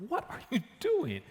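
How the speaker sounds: high-pitched incredulous challenge; retold indignation. Confronting person who blocked her.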